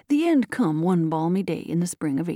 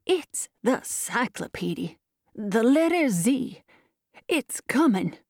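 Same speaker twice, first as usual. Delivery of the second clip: put-on slow, quavering old man's announcement; slow, exulting old-timer; aged man's cry; 4 semitones higher than usual. A narrator's reading. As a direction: draw it out like a dying old man in awe. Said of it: "cried the aged man".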